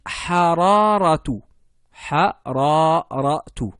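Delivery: hararatu syllable by syllable, slow and loud; slow word demo. Shown as a demonstration. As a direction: spell out "hararatu" slowly for repetition. (démonstration lente de hararatu)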